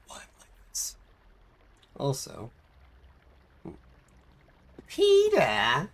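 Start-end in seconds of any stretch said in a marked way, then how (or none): 4.9-5.9 high, loud cartoon call. Streamer calling out in a cartoon voice. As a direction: yell the name across the room.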